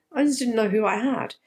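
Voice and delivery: normal talking voice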